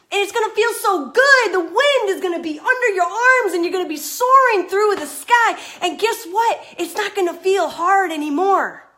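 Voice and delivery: high, loud, soaring build of real excitement; fired up. Real, not acted. Coach fired up live, urging viewers over the hill. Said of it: "I got fired up there".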